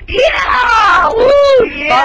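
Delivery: loud, high cheering; excited cheer. Acted. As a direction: whoop with joy. Kid offered a soda.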